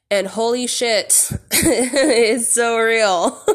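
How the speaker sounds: slow, laughing and half crying; laughing, near tears. Real, not acted. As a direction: laugh through it, a little overwhelmed. Overwhelmed by the realization.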